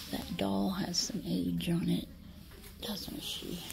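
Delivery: soft whispered aside; whisper, fading to almost nothing. Real, not acted. Shopper whispering to viewers about an old doll.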